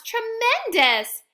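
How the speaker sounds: high, loud, slow cheering shout. Acted. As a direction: cheer it out in delight. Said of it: "Wanda shouts".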